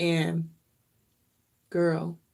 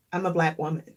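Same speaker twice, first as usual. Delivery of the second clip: normal talking voice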